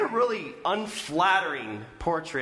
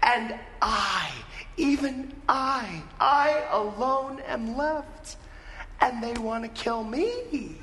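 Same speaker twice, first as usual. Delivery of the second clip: high-pitched, hammed-up wailing complaint; mock tearful blubbering; 9 semitones higher than usual. Elijah dumping his grievances on God, mock blubbering. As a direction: whine it out, stretching the words toward the end. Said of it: "blubbering through his tears, he says".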